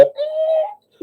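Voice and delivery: high squealed tyre noise; imitated skid screech. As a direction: screech like braking tyres.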